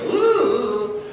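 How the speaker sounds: shudder out a high ooh at the snake; creeped-out ooh. Retold or imitated. Preacher acting his own fear of snakes, squeamish high ooh. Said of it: "gives me the creeps, and I go".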